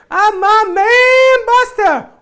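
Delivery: very high, loud wailing call, slow; falsetto cry to dog. Pretend. Falsetto wail calling out to the dog Buster. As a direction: wail the dog's name in falsetto.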